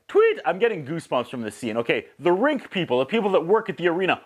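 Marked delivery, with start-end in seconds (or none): 0.1-0.4 high, sharp vocal "tweet" like a whistle blast; quick shrill-free whistle chirp; whistle imitation. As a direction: mimic the coach's whistle with your voice. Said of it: "tweet, again".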